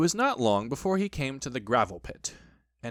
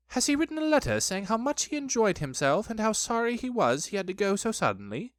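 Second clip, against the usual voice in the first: high, fussy questioning; fussy Rabbit; 8 semitones higher than usual. A narrator's reading.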